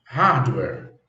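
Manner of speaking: "hardware" said as HARD-wer, stress on the first syllable; teacher shows "hardware" with stress up front, HARD-wer; correct pronunciation. Shown as a demonstration. Teacher showing students how to say it: "hardware" as HARD-wer. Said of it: "A sílaba tônica sempre vai estar em vermelho".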